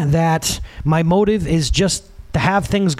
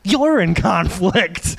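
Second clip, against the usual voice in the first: slow correction; much slower than usual. Retold or imitated. Correcting the kid.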